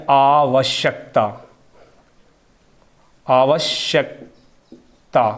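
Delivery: आवश्यकता very slowly, with a long आ at the start; correct long a. Shown as a demonstration. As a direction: stretch the first आ of आवश्यकता. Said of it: "यहाँ क्या होना चाहिए?". (आवश्यकता सही, लंबा आ, बहुत धीरे)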